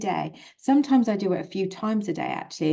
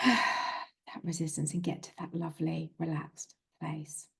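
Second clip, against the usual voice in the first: sighing release; 8 dB quieter than usual. Shown as a demonstration. Sighing into a relaxed place.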